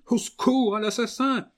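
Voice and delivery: scream for help. Acted. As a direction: scream it with all her strength. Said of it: "de toutes ses forces de sa voix ce cri". (hurlement de toutes ses forces)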